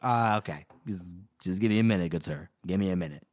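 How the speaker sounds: low, muttered stalling reply; lightly acted, low-pitched brush-off; put-upon clerk.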